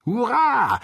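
high, loud cheering hurrah. A narrator's reading. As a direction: throw out a joyful cheer. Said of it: "riefen die beiden Gefährten".

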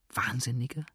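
whispered question to herself; dark whisper. A narrator's reading. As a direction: whisper it darkly, as if to yourself. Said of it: "flüsterte Georgine düster vor sich hin".

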